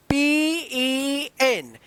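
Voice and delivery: each letter of पी ई एन drawn out with pauses; slow letter spelling. Shown as a demonstration. Teacher spells the word for students, slow and drawn out. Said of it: "अब इसको क्या पढ़ा जाएगा".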